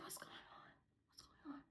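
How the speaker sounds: hushed, voiceless whisper to oneself; inner-thought whisper. Retold or imitated. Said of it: "In my mind, I'm like".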